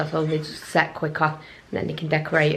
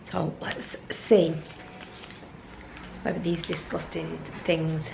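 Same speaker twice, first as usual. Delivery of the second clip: whispering; 10 dB quieter, slower than usual. Real, not acted. Teasing the kids' taste test.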